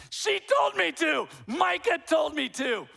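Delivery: loud, slow, high protest; defensive shout. Retold or imitated. Grandfather shouting back at his wife, loud slow protest. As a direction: yell it back in self-defense.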